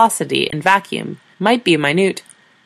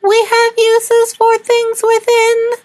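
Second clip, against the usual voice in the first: much higher, very slow alien squeal; a second, stranger voice from the device; different alien voice; 4 dB louder, much slower than usual. Acted. Translator switching voices for the aliens, very high and slow. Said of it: "it used a different voice".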